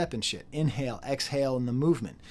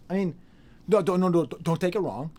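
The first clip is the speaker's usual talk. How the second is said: flustered protest; 5 semitones higher, 5 dB louder than usual. Real, not acted. Backpedalling flustered.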